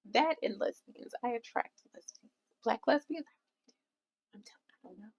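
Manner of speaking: very soft, confiding aside; hushed confession. Real, not acted. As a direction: drop almost to a whisper, as if letting out a secret. Hushed aside confessing whom she attracts.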